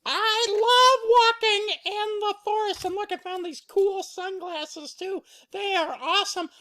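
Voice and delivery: squeaky, very high excited cub; Baby Bear. Acted. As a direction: gush in a tiny high voice. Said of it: "And Baby Bear".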